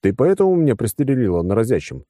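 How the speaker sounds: plain reading voice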